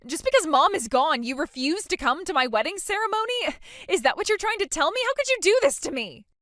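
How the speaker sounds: rising, aggrieved protest; indignant accusation. Acted. Bride to sister refusing to come to wedding, indignant.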